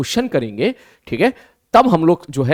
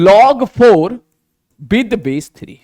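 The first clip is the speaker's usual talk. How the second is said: "log four", pause, "with base three", slow and deliberate; slow reading demo; 3 semitones higher, 4 dB louder, much slower than usual. Shown as a demonstration. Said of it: "बोल कैसे रहे इसको". ("log four with base three" धीरे, रुककर, साफ़ पढ़कर)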